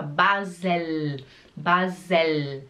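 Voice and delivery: BASEL with the final L held on the palate; tongue-up L. Shown as a demonstration. Teacher repeating for learners, lingering L. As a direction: let the L of "Basel" ring on. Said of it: "a língua é no céu da boca".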